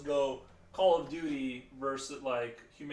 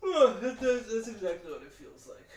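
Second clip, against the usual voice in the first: yawning; 6 semitones higher, 7 dB louder than usual. Real, not acted. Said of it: "Sorry for the yawning".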